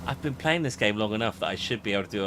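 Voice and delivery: ordinary delivery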